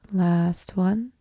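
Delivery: ordinary talk, nothing marked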